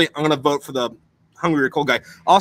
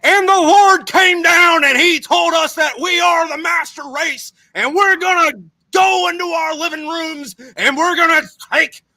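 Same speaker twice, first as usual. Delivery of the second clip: much higher, shouting ranting orator; Hitler-style speech; 13 semitones higher, 5 dB louder than usual. Retold or imitated. Mocking Hitler's rally speeches to his friend, a high shouted rant. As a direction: bellow it like a frenzied rally speech. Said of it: "doing their version of".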